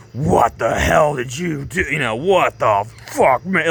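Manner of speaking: drawn-out, slow, appalled crowd voice; mock outrage. Onlookers scolding Rufus after the slap, mock outrage. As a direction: stretch each word in exaggerated disbelief. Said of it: "everyone was like".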